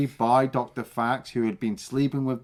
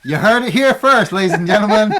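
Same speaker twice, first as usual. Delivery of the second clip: much louder, higher, laughing proclamation; mock announcer; 6 semitones higher, 11 dB louder than usual. Announcing his cohost's hot take, laughing. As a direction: announce it to an audience while laughing.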